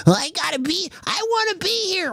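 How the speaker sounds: high, soft mocking whine; mock desperate pleading. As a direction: plead in a squeaky put-on little voice. Mocks people desperate to be there.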